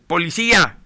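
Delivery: grito fuerte y alto que cae al final; grito de auxilio. Retold or imitated. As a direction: exige a la policía a gritos. (grito fuerte pidiendo policía ante el agresor)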